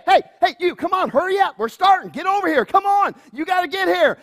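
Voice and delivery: high, loud call to stragglers. Retold or imitated. Youth pastor calling the kids at the back, high and loud. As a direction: hurry them up from across the room. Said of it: "a youth pastor will be like".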